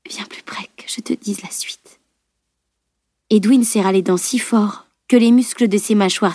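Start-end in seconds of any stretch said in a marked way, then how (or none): 0.1-2.0 very soft, breath-only whisper; faint whisper. A narrator's reading. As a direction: draw the listener close with a dying whisper. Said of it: "chuchota-t-elle en grimaçant". (chuchotement à peine audible)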